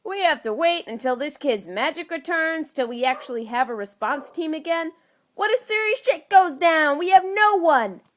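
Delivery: alarmed outburst. Acted. Fury to Tony about the team, rising alarmed shout. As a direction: sound the alarm, voice climbing.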